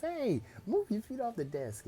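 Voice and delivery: high-pitched scolding call; indignant scolding. Acted. Classmate rushes over to Bakugo, scolding him sharply. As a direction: jump high on the hey to tell him off.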